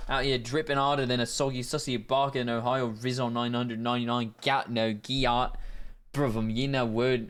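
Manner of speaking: slangy British lad voice; British NPC accent. Acted. British NPC leaving a voicemail, put-on British accent. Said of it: "N P C with max aura leaves a voicemail".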